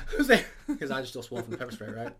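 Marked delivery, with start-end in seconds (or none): 0.1-0.4 scared call. Acted. Bigfoot calls out.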